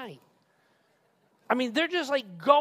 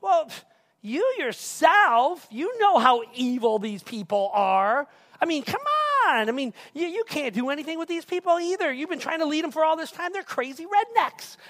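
blame-shifting; 5 semitones higher, 6 dB louder than usual. Acted. Aaron excuses himself to his brother Moses, shifting blame. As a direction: plead exasperatedly and push the blame back. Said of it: "makes a classic move to the blame game".

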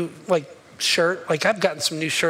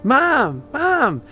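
put-on little girl calling; 6 semitones higher, 3 dB louder, much slower than usual. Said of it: "the little girl kept going".

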